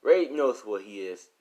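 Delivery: ordinary delivery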